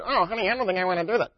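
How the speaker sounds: lightly put-on wife, quick and fussy; preacher mimicking a wife brushing off her husband, fast; mock wife voice. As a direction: play the reluctant wife for laughs, quick. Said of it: "I know you don't talk like that".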